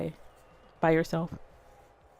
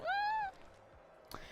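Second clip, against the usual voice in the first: a squeaky, tight little hmm; high pointed hum; 17 dB quieter than usual. Retold or imitated. Streamer recalling being ignored by a friend, squeaky hum.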